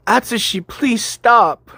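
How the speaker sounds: high, loud pained cry; desperate plea. A narrator's reading. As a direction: beg him to stop, voice shooting up. Victim begging torturer to stop.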